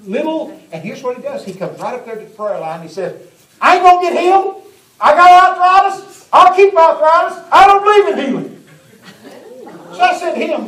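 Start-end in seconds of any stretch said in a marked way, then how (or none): 3.6-8.5 put-on high, loud, defiant old codger; loud mock grumpy old man; stubborn old man. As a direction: bark it like a cranky old fella. Preacher voices stubborn old man.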